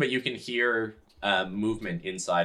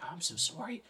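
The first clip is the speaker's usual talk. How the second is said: hushed, higher, tender apology; soft, fervent vow under the breath; whispering to a book; 6 semitones higher, 6 dB quieter, faster than usual. Acted. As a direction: murmur remorsefully to something treasured. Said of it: "like, whispering to the book".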